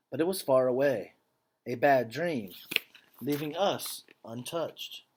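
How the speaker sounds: earnest, measured read-aloud voice; story narration. A narrator's reading. Teacher reading a picture book to students, earnest storytelling. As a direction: read the story to the class with feeling.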